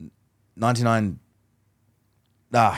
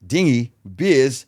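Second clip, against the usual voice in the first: "Dinghy?" and "Beers?" echoed higher and louder as eager questions; louder, higher echoes of "Dinghy?" and "Beers?" to keep him talking; curious echo questions; 5 semitones higher, 5 dB louder, much slower than usual. Shown as a demonstration. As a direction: bounce "Dinghy?" then "Beers?" back, keen to hear more. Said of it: "repeating the last one or two words with a question mark".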